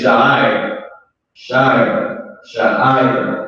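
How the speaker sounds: "Sha'ir" said as sha-ee-ir with a rising end; careful pronunciation. Shown as a demonstration. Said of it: "That's how you say it".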